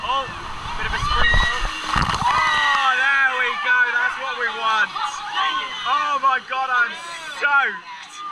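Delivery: excited. Real, not acted. Soaked by the wave.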